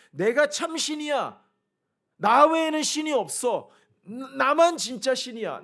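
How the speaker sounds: preaching at full force, slow and loud; slow booming declaration. Acted. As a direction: proclaim each word as the only true God. God's declaration, building.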